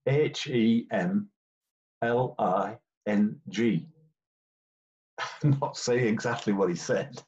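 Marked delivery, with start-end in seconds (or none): 0.1-3.9 slow, deliberate letter by letter; spelling it out. Retold or imitated. Referee spelling the captain's name for the book, slowly. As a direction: spell each letter out slowly, making a point.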